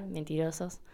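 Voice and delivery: soft, dry teasing jab; teasing mock accusation. Real, not acted. Teasing listeners who said they'd miss her.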